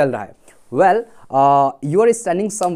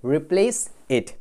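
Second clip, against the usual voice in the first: "Replace it" slow and lower, in separate words; unhurried, lower teacher's model of "Replace it"; repeat-after-me model; 4 semitones lower, much slower than usual. Shown as a demonstration. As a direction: draw out "Replace it" for learners to copy. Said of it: "Repeat after me".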